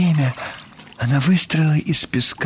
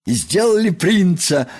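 joyful; 6 semitones higher than usual. Acted. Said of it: "радостно сообщил Крош".

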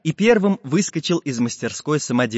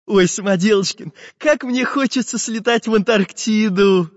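a hint of a dreamy artist; wishfully longing, lightly voiced; 8 semitones higher than usual. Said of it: "мечтательно сказал Карандаш".

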